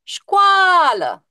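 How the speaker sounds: școală called out loud and high, A stretched; calling out. Shown as a demonstration. Teacher demonstrates calling out the word to the class. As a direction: shout școală as if calling a friend.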